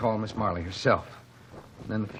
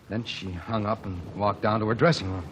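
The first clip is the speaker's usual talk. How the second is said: normal talking voice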